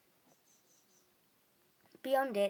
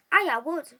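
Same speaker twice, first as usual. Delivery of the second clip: gruff farmer; 6 semitones higher, 14 dB louder than usual. A narrator's reading. Campsite owner answers.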